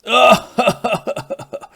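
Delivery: loud laugh. Acted. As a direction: burst out laughing. Śmiech po upadku. (głośny śmiech)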